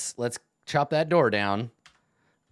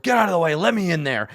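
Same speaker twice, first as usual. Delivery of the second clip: bugbear shouting; 6 semitones higher, 6 dB louder, faster than usual. Acted. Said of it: "The bugbear is shouting to the front goblin".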